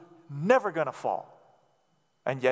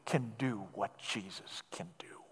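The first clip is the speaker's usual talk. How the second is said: hushed whisper, much softer; whispered conviction; 10 semitones lower, 14 dB quieter than usual. Acted. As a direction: breathe it out like a secret truth. Sermon climax dropped to whisper.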